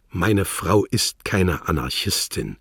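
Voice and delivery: plain reading voice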